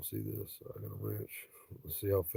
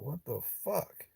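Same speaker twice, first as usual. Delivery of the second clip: slow, higher baffled what the fuck; drawn out in mock confusion; lightly voiced baffled cop; 6 semitones higher, 7 dB quieter, slower than usual. Cop's baffled reaction retold.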